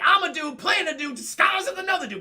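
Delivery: high, loud put-on voice; hammed-up defensive outburst; frantic declaration. Rattled actor blurts his identity.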